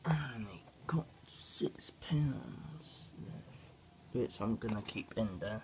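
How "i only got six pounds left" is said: slow whispered aside; whispering. Real, not acted. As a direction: whisper it slowly, as a secret. Aside about money left.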